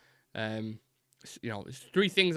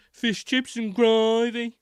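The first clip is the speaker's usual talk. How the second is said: high, loud, very drawn-out Brummie; Brummie accent; 10 semitones higher, 6 dB louder, much slower than usual. Retold or imitated. Mimicking the donut seller's catchphrase, strong Brummie accent. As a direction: stretch it out in exaggerated Birmingham tones. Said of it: "It's what he said, gravy".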